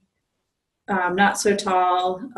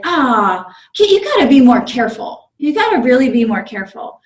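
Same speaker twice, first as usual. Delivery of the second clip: higher, louder mock lecture to the kid; patronizing conductor; 4 semitones higher, 7 dB louder than usual. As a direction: talk down to him like a condescending official. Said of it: "So then he's like".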